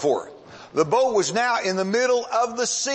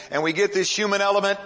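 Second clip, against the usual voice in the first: ordinary delivery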